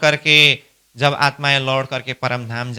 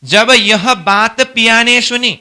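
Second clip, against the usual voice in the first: recited verse; 4 semitones higher, 3 dB louder than usual. Shown as a demonstration. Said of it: "प्रकटवाणी की चौपाई में बोल रहा हूं".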